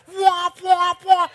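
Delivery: loud, high-pitched car alarm whoops; mimicked alarm going off, high and loud; car alarm imitation. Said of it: "it's going off again".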